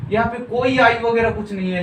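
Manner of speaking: ordinary delivery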